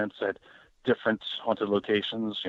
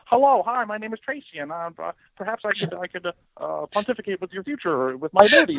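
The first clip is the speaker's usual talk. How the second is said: much higher, hammed-up pompous self-introduction; pompous stranger; 10 semitones higher than usual. Mocking a stilted intro to a room, high pompous voice. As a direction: play an absurdly formal stranger, high and fast at the end. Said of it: "walk into a room and go".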